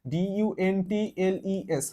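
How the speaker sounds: ডিইউএনটিএলইএস spelt out letter by letter, slowly; slow letter-by-letter spelling. Shown as a demonstration. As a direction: dictate each letter of ডিইউএনটিএলইএস to the class. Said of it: "এটাকে বলা হয় ডাউন্টলেস".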